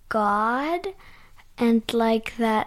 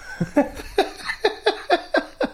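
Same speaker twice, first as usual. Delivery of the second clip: high, breathy giggling; long run of high, light giggles; 10 semitones higher than usual. Real, not acted. Child laughs after saying she likes it.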